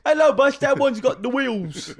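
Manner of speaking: high, loud British caricature; mock British accent. Co-host greeting pretend bus, high loud mock British. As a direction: call out in silly British, stretching the end.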